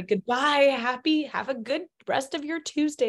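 lightly hammed-up farewell to the class; mock cheerful goodbye. Teacher teasing her students with a pointed goodbye. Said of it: "I made sure to say".

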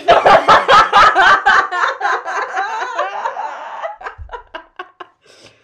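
high, loud real laughing fit; helpless with laughter; long loud laughter. Real, not acted. Langes lautes Lachen. (langer Lachanfall)

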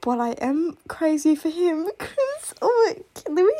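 laughing, near tears. Real, not acted. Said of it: "I'm actually crying thinking about him".

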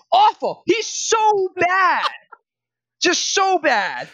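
high, slow, drawn-out disgust; a fan groaning over his worst player, exasperated and slow. Real, not acted. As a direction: stretch out the so bad in disbelief. Exasperated rant about player.

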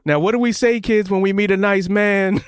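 imagined mother coaching her kids in the car, lightly mock. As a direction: play a sugary mom prompting her kids. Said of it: "I imagined her getting in the car and saying".

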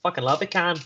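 broad mock Australian accent. Host shows his favourite accent, Australian impression. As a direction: throw in a playful Aussie twang. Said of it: "my pick would be an Australian accent".

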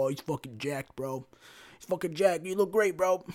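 lightly put-on hype-bro praise; mock admirer. Onlookers praising someone's jacked body, mildly mock gushing. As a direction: gush a little, mockingly. Mocking admirers of posted physiques.

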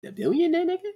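high mocking disbelief; incredulous question. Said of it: "we gotta find Bruce Wayne".

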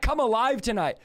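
ordinary talk

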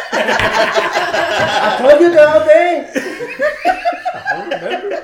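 laughing through the words; cracking up while quoting. Retold or imitated. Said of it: "she mumbles something".

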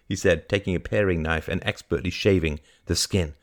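ordinary delivery

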